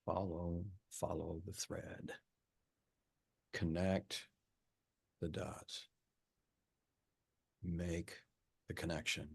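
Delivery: puzzling it out quietly alone; soft brooding self-talk; muttering to himself. Acted. Staring at wall, deep concentration.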